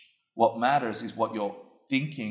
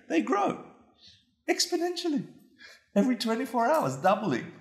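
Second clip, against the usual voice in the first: soaring emphasis; 12 semitones higher than usual. Real, not acted. To the class about thoughts multiplying, a soaring rising voice. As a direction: voice climbing way up on exponential growth. Building up exponential growth.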